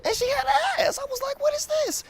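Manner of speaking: high, incredulous "what is this"; amazed. Real, not acted. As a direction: squeal it out in disbelief. Amazed at women's figures.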